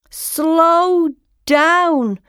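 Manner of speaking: high, drawn-out storybook shout; calling out. A narrator's reading. Frog Lim calling after his speeding brother, high slow shout. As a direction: call after someone ahead, stretching the words. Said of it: "shouted Lim".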